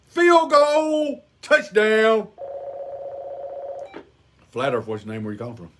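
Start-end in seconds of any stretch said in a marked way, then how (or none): 4.5-5.7 low, soft radio host voice; deep mock call-in show host. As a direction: answer a caller like a late-night host.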